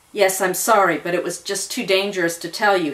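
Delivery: plain reading voice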